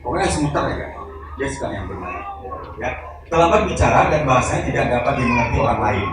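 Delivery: normal talking voice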